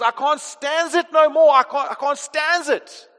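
put-on loud, high outburst in an American accent; Popeye. Preacher quoting a cartoon sailor's breaking point, loud American impression. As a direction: declare it loudly as a fed-up American cartoon sailor. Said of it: "he declares in his American accent".

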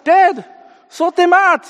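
high, loud put-on appeal; mock fed-up son. Son tells Dad to handle the kids, mock exasperation.

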